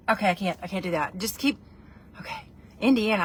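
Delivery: ordinary talk, nothing marked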